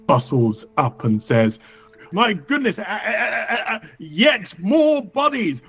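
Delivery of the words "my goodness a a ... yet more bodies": high-pitched, stammering dismay; flustered law speaker. Acted. Law speaker bustles up to find more bodies, high flustered dismay. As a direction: wail it like an overwrought official.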